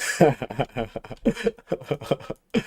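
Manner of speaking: real, drawn-out chuckling; laughter. Real, not acted. Streamer amused by chat's reply, laughter. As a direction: laugh it out in a long run.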